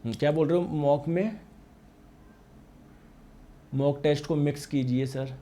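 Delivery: usual speaking voice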